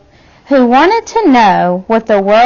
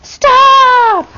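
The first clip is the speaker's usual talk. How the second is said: a loud, high parental shout; yelled stop; 12 semitones higher, 3 dB louder than usual. A narrator's reading. Parents yelling at Ada to halt the experiment, loud and high. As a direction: cut her off sharply. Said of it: "her parents yelled".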